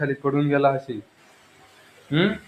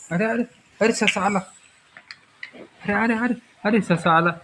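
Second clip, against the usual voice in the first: higher, eager oh-oh-oh for the rabbit; mock excitement; 6 semitones higher than usual. Parent to toddler as the toy rabbit comes, excited play. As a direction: cheer the rabbit in for the child.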